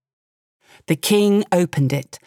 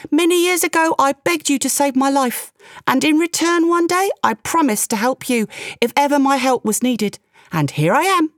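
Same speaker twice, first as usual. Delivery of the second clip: high-pitched small creature; tiny bee voice; 7 semitones higher than usual. A narrator's reading. Bee reminds the king of an old promise, high tiny voice. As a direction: speak high, as a little bee. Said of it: "a tiny voice piped".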